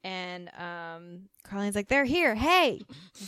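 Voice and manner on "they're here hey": higher, louder retold call-out; excited greeting. Carlene greets the men coming back, excited call-out. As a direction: call out a hey as they arrive.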